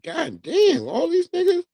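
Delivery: a viewer scrolling, staggered by the numbers, rising disbelief; shocked disbelief. Retold or imitated. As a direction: act stunned at how many there are. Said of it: "You be like".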